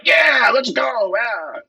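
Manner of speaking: high, slow mock cheer. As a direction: cheer like an eager follower. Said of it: "They're like".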